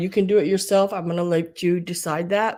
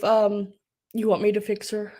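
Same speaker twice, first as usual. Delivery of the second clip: ordinary talk, nothing marked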